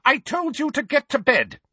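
very high, exasperated scolding; snappish. Acted. Aunt cutting off her nephew's questions, exasperated. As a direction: repeat the order at the end of your patience.

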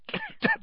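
choking cough on ash. Acted. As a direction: hack out a sudden cough. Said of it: "swallowed a lot of hot ash".

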